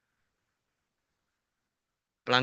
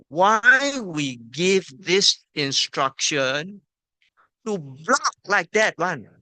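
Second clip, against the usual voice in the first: ordinary talk, nothing marked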